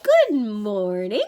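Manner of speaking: slow, loud, stretched-out greeting; glazed crew member. A narrator's reading. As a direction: greet like someone hypnotized. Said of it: "his eyes glazed".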